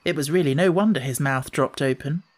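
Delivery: ordinary delivery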